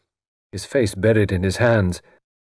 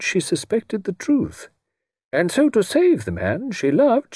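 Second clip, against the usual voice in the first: put-on higher, careful elderly gentleman laying out his theory; Satterthwaite's modest voice; 10 semitones higher than usual. Satterthwaite modestly explaining Eleanor's motives to the room, higher gentle voice. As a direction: speak gently like a shy onlooker turned actor.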